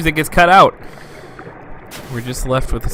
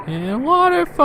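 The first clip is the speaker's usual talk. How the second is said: high character voice; 14 semitones higher than usual. Acted. Voicing a game character.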